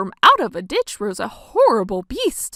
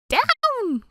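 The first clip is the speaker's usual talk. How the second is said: high urgent call; witch crying out; 12 semitones higher than usual. Acted. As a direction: order everyone down, quickly. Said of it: "cried the witch".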